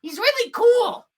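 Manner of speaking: very high, loud little creature voice; excited Bug. Acted. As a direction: squeal it with eager pride. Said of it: "Bug goes".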